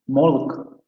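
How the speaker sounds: molk, with the vowel rounded to an o; S0 contrasting milk with molk. Shown as a demonstration. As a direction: say molk as in the recording, not milk. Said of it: "En lugar de milk".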